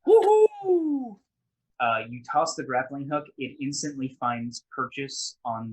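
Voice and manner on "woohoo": high, loud whoop; excited cheer. Real, not acted. Cheering a dice roll.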